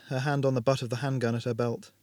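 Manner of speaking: ordinary delivery, nothing marked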